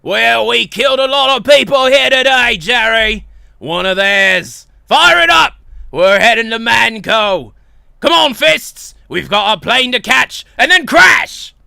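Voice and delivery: booming, gung-ho action boss; Saxton Hale. Acted. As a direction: shout it like a reckless adventurer rallying himself. Boss rallies his crew.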